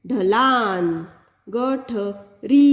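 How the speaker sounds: ढलान and गठरी drawn out slowly; slow teacher demo; slow clear reading. Shown as a demonstration. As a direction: read slowly for pupils. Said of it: "बड़ी ई है".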